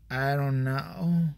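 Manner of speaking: mock the clerk's dull, slow reply; slow mocking drawl. Comic mocking the gym clerk, a slow dragged-out voice. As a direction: drag it out slowly like a bored clerk. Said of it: "just like that too".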